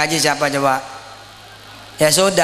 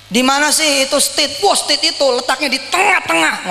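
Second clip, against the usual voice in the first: upbeat, higher-pitched selling voice; confident promoter; 8 semitones higher than usual. Shown as a demonstration. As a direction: pitch the place like a proud promoter. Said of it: "Ngomongnya harus begitu". (dengan nada tinggi, penuh percaya diri)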